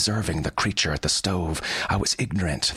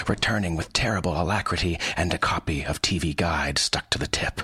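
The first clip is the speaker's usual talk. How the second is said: plain reading voice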